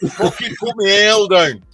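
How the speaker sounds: Scottish husband demonstrating for wife, a pub threat in a mock accent. Shown as a demonstration. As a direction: growl it like a Glasgow drinker whose pint got lifted. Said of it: "Say that again".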